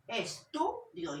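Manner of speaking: Estudio slowly split, es-TU-dio, middle syllable strongest; slow Estudio with the weight on tu; stress on TU. Shown as a demonstration. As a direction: lean on tu in Estudio.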